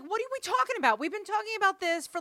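high, loud incredulous protest; retold outburst of disbelief at her son; exasperated mother.

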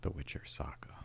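dramatic whispered echo. Pretend. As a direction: hush the title like a secret.

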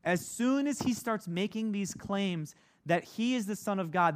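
usual speaking voice

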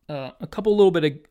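strained words right after a burp; talking while burping. Real, not acted. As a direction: still catching the burp. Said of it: "sorry, I'm burping".

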